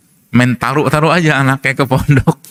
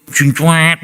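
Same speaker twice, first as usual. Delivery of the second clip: drawn-out, higher swoosh of a passing car; imitated swerving noise; 5 semitones higher than usual. Telling how a car overtook him, imitated swoosh. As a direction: mimic a car whipping past.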